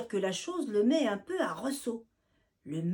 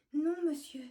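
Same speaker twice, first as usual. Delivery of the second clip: trembling; 6 semitones higher than usual. Acted. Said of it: "répond-elle, tremblante".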